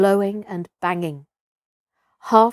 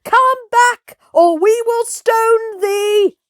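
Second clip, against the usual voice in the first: threatening shout; 14 semitones higher, 6 dB louder, slower than usual. Acted. Crowd threatening Messua.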